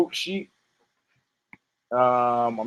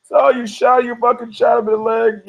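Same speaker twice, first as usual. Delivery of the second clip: high, agitated mock panic; imitating a shaken passenger; freaking out; 7 semitones higher than usual. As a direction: keep your pitch up, rattled. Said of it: "the guy's still freaking out".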